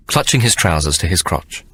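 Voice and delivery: ordinary talk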